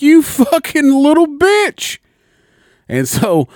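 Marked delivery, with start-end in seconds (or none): 0.0-2.0 much higher and louder, dragged out slowly; retold insult. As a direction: spit the insult out in disbelief. Friend's insult at peak anger.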